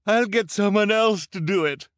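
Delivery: high, loud, self-important cry; very high-pitched loud henchwoman; Esme Squalor. A narrator's reading. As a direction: cry out over the clamour. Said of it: "cried Esme Squalor".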